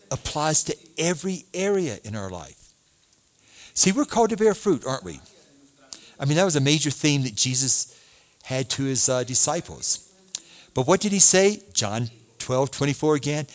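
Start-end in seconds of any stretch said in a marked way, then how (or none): none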